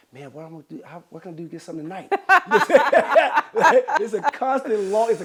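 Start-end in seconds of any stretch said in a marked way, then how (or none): 0.1-2.1 quiet scheming mutter. Retold or imitated. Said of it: "he's like". 2.2-3.9 big high burst of laughter; real laugh; loud laugh. Real, not acted. Laughs after his own joke.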